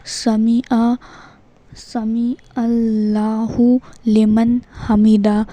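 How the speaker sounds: slow, stretched syllables with pauses between phrases; careful slow recitation. Shown as a demonstration. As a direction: teach it phrase by phrase, unhurried. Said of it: "اس کو بھی اچھے سے یاد رکھیں".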